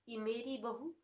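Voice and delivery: normal talking voice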